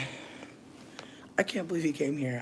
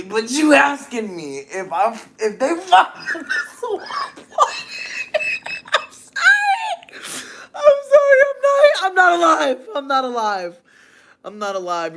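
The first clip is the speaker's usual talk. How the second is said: high, loud laughing squeal fading back down; laughing so hard; 11 dB louder than usual. Real, not acted. Streamer cracking up at the chat's swamp question, wheezing with laughter. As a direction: shriek with laughter until you can't breathe. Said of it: "I literally can't breathe".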